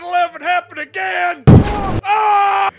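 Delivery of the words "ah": sudden loud yell of alarm; loud startled scream. Pretend. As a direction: scream as the jet is hit. Getting shot in the jet.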